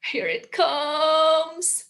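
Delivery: slow drawn-out dread. Pretend. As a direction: drag it out with dread. Dread before silly sounds.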